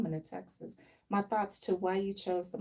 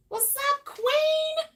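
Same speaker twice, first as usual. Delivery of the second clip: much higher, louder "What's up, queen"; excited greeting; 7 dB louder, much slower than usual. Real, not acted. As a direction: hype the client up as she sits down. Greeting next client.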